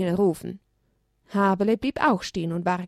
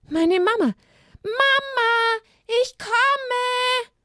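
excited little lamb shout; much slower than usual. Acted. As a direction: cry out joyfully running to mama. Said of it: "schrie Haberle". (aufgeregter Schrei des Lämmchens, hoch und laut)

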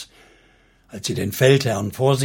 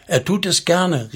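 higher-pitched interjection; calling out; 6 semitones higher than usual. A narrator's reading. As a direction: throw it in with a mild call. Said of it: "rief ein anderer Fürst".

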